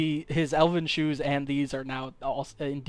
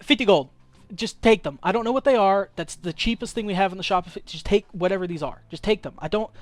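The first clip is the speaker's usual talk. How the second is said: higher, hurried pleading; flustered shopkeeper; 4 semitones higher, faster than usual. Acted. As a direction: beg the customer to take it away. Shopkeeper desperate to be rid of shoes.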